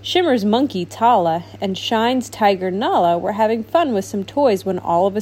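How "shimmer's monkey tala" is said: plain reading voice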